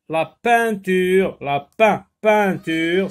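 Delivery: peinture said loud and slow, restarted; teacher modelling a word; loud slow model word. Shown as a demonstration. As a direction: project peinture clearly to the class. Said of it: "le son un s'écrit E I N".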